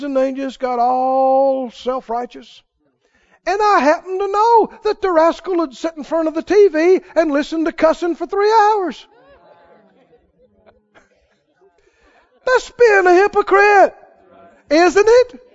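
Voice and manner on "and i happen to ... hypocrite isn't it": loud, rising preacher's voice at full force; fiery preaching. Acted. Preacher condemning hypocrites to his congregation, loud and fervent. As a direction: drive the point home to the congregation.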